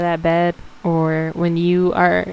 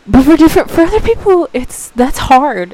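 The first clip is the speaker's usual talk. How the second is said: strained, louder and higher, close to tears; voice breaking; 8 semitones higher, 8 dB louder than usual. Real, not acted. Telling listeners how hard getting up is, voice breaking. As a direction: push through the lump in the throat, slowing at the end.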